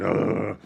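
imitated growl of rage; angry growl. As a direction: growl like someone fuming. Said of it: "he's angry, and he's like".

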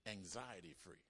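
ordinary talk, nothing marked

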